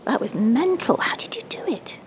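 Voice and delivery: loud, high, thrilled exclamation; amazed. Acted. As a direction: gush in amazement, drawing out the start. Boy amazed after magical jump.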